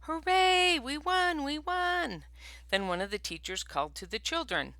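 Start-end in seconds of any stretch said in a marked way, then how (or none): 0.0-2.3 high, loud, excited cheer; children's victory cheer, high and loud. Acted. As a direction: shout it like winning kids. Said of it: "The other team cheered".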